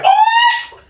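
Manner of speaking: shouted high shocked what. Retold or imitated. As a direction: yelp it in disbelief. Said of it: "would you be like".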